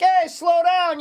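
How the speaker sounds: high, loud call across the yard. Retold or imitated. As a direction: shout a friendly warning. Calling to kids on bikes.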